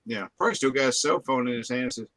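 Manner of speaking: usual speaking voice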